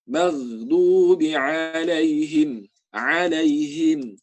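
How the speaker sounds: alaihim slow with a throaty ain; ain sound. Shown as a demonstration. Teacher showing the ain in alaihim. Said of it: "Alaihim itu ain juga".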